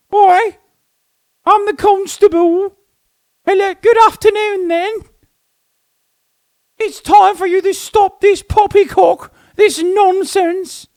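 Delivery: very high, prim English bobby; squeaky stiff-upper-lip policeman; posh constable voice. Pretend. Mocking police dressed up.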